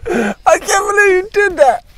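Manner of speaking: high, crying shout of disbelief; shocked shriek. Real, not acted. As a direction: shriek it in horror. Friend eats fermented fish.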